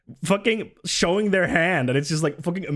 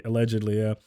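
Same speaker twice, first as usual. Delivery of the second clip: ordinary talk, nothing marked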